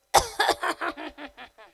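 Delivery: loud, drawn-out fake cough; signalling throat-clear. Shown as a demonstration. Wife hinting she needs a word, loud fake throat-clearing. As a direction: clear the throat pointedly to get noticed. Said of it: "याला खाकरणं, खोकरणं म्हणतात".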